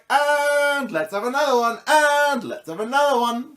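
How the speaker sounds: high, repetitive sing-out, stretching each "and"; mock a crowd keenly lighting up one after another; imitated eager smokers. Imitating Danes chain-smoking together, high and mock-eager. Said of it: "everyone was basically like".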